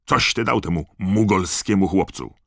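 cross father raising his voice; angry shout. A narrator's reading. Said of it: "krzyknął".

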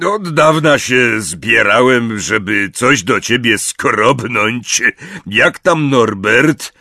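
a big friendly giant reminiscing; Hagrid's voice. A narrator's reading. Hagrid to Harry, fondly remembering. Hagrid asks about Norbert.

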